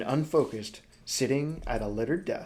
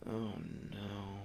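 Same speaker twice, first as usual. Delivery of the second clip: much softer, groaned under the breath; inward groan; 2 semitones lower, 10 dB quieter than usual. Acted. Helva dreading Broly's preaching, groaning softly to herself. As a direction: let it out as a quiet inner groan. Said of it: "she groaned inwardly".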